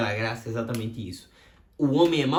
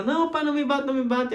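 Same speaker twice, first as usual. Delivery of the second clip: put-on pleading child; 12 semitones higher, 3 dB louder than usual. Child begs father not to hit.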